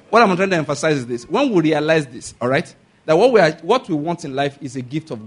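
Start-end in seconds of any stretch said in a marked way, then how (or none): none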